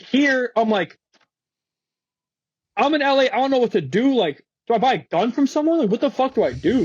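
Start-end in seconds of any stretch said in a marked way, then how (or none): 2.8-6.8 high, mildly panicked retelling; retold tripping. Retelling his worry alone in L.A., mildly freaked out. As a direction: pitch it up as if at a loss. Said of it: "I start tripping".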